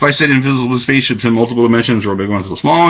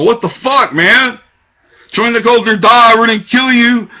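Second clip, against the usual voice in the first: mocking accusers; 7 semitones higher than usual. Voicing the locals threatening him, higher mock threat.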